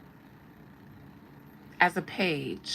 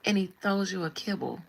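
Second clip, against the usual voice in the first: usual speaking voice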